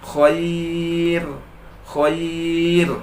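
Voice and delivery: Khair with a light thin final r. Shown as a demonstration. Teacher models Khair for students, thin ra. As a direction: recite Khair, keep the r thin. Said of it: "Tak boleh dibaca tebal".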